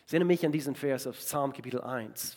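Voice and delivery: usual speaking voice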